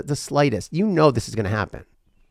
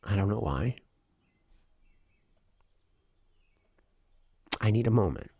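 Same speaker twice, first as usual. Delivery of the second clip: getting emotional; 3 dB quieter, faster than usual. Real, not acted. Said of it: "I'm kinda getting a little emotional".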